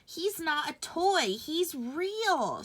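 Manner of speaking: put-on a small boy defending his rabbit; boy insisting. A narrator's reading. Boy correcting his mother, slow and high. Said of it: "said the boy".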